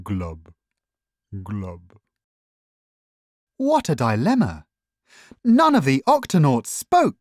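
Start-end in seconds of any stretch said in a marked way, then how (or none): 0.0-2.0 low, soft, gloomy glub; glum fish. A narrator's reading. The sad fish replying to Dashi, glum and low. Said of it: "But the fish only replied".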